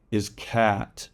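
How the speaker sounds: ordinary talk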